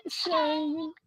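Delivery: high squeaky Little Shane voice; voice changer. Pretend. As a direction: chipmunk voice through a changer. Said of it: "That's my voice changer".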